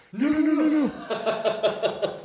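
high, rushed panicked nos; mock alarm; frantic no. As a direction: stop him in a panic, way up high. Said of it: "And they're like".

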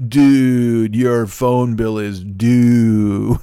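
loud drawn-out Dude, then a very slow put-on slacker voice. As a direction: stretch it out like a lazy surfer dude. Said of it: "I read every email like that".

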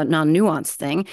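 ordinary talk, nothing marked

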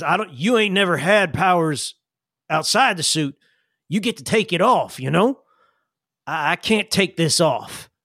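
folksy character voice, halting and earnest; Sam. Acted. Sam advises Jillian on powers, folksy and earnest. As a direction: reason it out in plain country talk. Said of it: "He says".

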